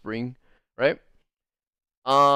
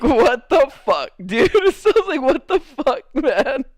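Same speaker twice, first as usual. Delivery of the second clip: hammed-up bewilderment, pitched way up; incredulous outburst; 8 semitones higher than usual. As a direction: protest in baffled, laughing exasperation. Acting his bewildered reaction.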